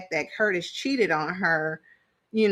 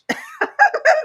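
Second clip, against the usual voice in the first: high burst of laughter; 5 dB louder than usual. Real, not acted. Laughing after punchline.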